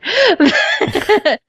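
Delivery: sudden high-pitched laugh. Real, not acted. Laughs after forgetting the name.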